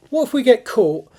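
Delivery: high, loud worried question; nervous. A narrator's reading. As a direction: blurt it out as a nervous boy. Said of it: "Alfie had a nervous frown".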